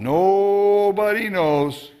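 milk it, stretching the words slowly; mock self-pity. Preacher voicing someone who feels all alone, mock self-pity.

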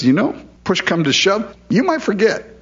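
high, casual concession; conceding God. Retold or imitated. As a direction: toss it off lightly. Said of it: "God says".

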